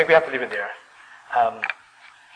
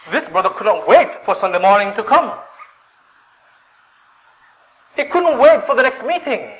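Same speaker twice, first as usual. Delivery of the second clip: higher-pitched, urgent preaching; fervent preaching; 9 semitones higher than usual. Real, not acted. Preacher tells of a man desperate for God, fervent. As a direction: press the longing on the congregation.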